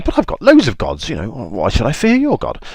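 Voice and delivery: high, loud mock indignation; swooping, incredulous protest; defiant Pharaoh. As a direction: scoff as an affronted king. Pharaoh defies Moses' God.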